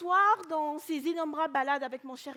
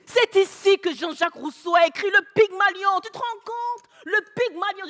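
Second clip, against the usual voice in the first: high, loud, impassioned exclamation; awed proclamation; 5 semitones higher, 10 dB louder than usual. Acted. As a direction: proclaim it as a momentous landmark. Husband exclaims at Rousseau's statue.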